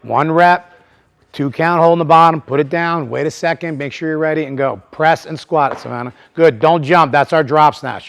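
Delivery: loud, high calls to the lifters; coaching calls. Real, not acted. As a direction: keep cueing them through each rep. Coach cueing several lifters live.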